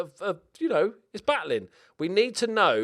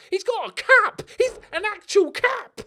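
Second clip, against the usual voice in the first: high, loud delighted shout; thrilled shout; 9 dB louder than usual. Real, not acted. To viewers, thrilled the player has a cap, high loud shout. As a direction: yell it out in delight.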